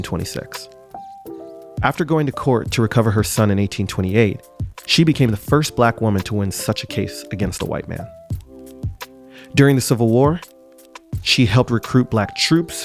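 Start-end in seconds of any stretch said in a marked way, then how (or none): none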